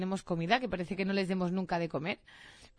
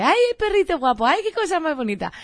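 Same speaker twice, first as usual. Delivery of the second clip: put-on high, cooing baby talk to a dog; silly dog-talk voice; 7 semitones higher, 8 dB louder than usual. Tourist woman cooing at her big dog, high baby talk. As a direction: coo at the puppy like it's a baby. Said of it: "este tono idiota que tenemos con los perros".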